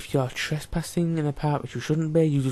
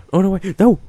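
alarmed; 4 semitones higher, 9 dB louder than usual. Real, not acted. Realises mistake in game.

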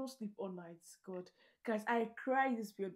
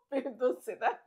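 laughing protest; 2 semitones higher, 3 dB louder than usual. Real, not acted. Teasing about the dessert.

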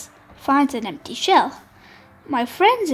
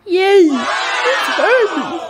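much louder, higher, slow joyful shout; excited shout; 6 semitones higher, 6 dB louder, much slower than usual. Acted. Smallest crab greets Hermie on the beach with a shout. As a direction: cheer him home. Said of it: "the smallest crab shouts".

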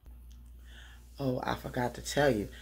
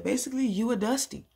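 high, louder, full of attitude; mock sassy put-down, raised and loud; sassy insult; 10 semitones higher, 5 dB louder than usual. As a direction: look him up and down and say it. Retold insult to father.

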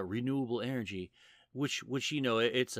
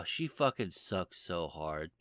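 ordinary delivery

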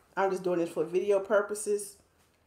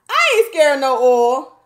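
defiant; 5 semitones higher, 13 dB louder than usual. Real, not acted. Defiant loud retort.

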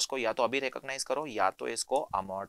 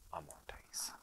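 whispered; 8 semitones lower, 17 dB quieter, slower than usual. Real, not acted. Whispered conclusion.

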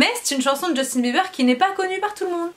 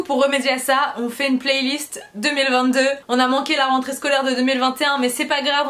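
normal talking voice

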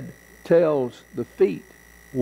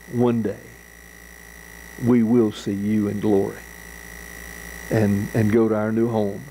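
prayer; 4 semitones lower than usual. Acted. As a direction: pray it low and humbly. Closing prayer.

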